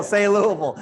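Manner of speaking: Kentucky local's slurred Louisville; Kentucky accent. Shown as a demonstration. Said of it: "Kentucky accent".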